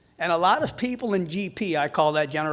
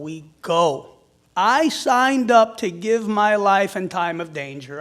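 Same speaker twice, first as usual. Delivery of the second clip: slow, loud, earnest plea; rallying appeal; 3 semitones higher, 6 dB louder, slower than usual. Real, not acted. Firefighter asking the crowd to keep the screenings going, loud appeal. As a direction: drive each word home to the crowd.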